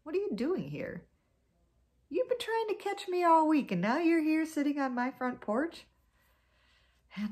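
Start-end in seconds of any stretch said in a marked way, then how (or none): 0.0-5.9 higher, louder incredulous snake; indignant surprise. Acted. Snake finding Anansi on his porch, higher and louder in surprise. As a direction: ask it like you can't believe the nerve. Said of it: "And Snake came out and said".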